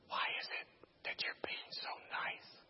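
very soft whispered question; hushed, voiceless query; whispering. Retold or imitated. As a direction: whisper it, barely audible. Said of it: "whispering to each other again".

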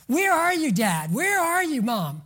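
high, loud desperate cry for a parent; a son pleading for his dad and mom; child crying out. Acted. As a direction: call out like a child left alone. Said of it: "your son crying out".

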